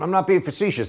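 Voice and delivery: lightly mimicking a politician; a hint of presidential parody; Joe Biden. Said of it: "big words Joe Biden knows".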